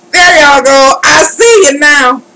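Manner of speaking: high, loud burst of relief; relieved outburst. Real, not acted. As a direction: call it out as it finally works. Screen finally works after long struggle.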